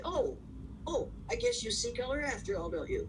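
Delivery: high, sing-song gotcha; spring the trap with mock delight; mock triumphant gotcha. Telling someone who claims not to see color that they do, mocking sing-song.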